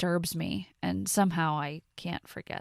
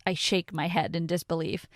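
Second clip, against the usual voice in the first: ordinary delivery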